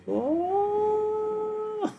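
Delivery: long, high held "ohhh"; sustained falsetto wail, imitated. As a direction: hold one very high note on "oh". Imitates noise as switch goes up.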